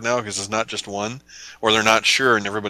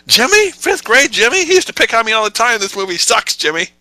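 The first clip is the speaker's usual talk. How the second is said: indignant shout; 13 semitones higher, 9 dB louder than usual. Pretend. Mocking moviegoers who skip credits.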